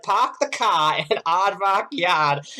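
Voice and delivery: imitating a thick Boston accent, dropped r's. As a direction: drop the r's like a Boston guy struggling. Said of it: "overcome his Boston accent".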